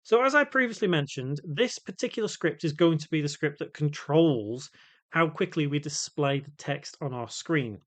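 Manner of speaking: ordinary talk, nothing marked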